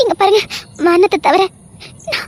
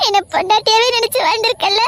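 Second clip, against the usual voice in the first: normal talking voice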